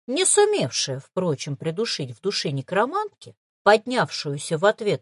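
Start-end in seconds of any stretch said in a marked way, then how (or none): none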